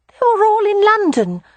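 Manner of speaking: put-on high child's voice; high childish voice; small boy. A narrator's reading. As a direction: answer like an eager little boy.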